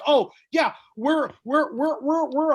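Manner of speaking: high, loud snooty hipster voice, stammering; snobby bar owner. Retold or imitated. Comedian mocks a pretentious bar owner, snooty hipster voice. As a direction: put on a pretentious bartender. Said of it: "places that were like".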